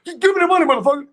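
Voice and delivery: high, loud stick-up demand; comic high-pitched holdup shout; mock robbery shout. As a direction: yell it like a hammed-up robber. Acts out a retold stick-up.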